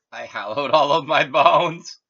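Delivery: loud, high, drawn-out gleeful proclamation; gleeful boast. Real, not acted. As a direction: proclaim it slowly and loudly with laughing glee. Boast half laughed, half sung out.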